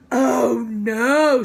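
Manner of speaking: loud, shocked oh no; alarmed cry. Acted. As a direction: cry out at the stain. Lucille alarmed at the spill.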